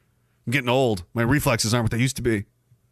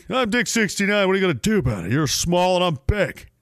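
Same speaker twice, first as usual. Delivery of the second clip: lightly voiced taunting bully; mock bully; 4 semitones higher than usual. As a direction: taunt a little kid for laughs.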